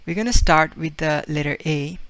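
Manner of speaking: ordinary talk, nothing marked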